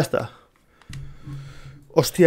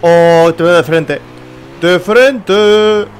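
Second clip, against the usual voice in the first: shocked shout; 6 dB louder, much slower than usual. Real, not acted. Gamer yelling as a car comes head on, loud shout. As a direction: yell as the car meets you head on.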